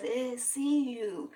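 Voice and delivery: high, slow, playful mimicry of oneself; silly put-on voice. Shown as a demonstration. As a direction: redo it in a silly, stretched-out voice. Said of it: "Why I do that with my voice?".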